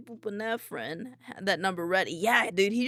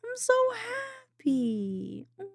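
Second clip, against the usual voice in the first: very high, happy squeaky whine; touched, near tears; 12 semitones higher than usual. Real, not acted. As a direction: squeak it slowly, overcome with happiness. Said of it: "you're gonna make me cry".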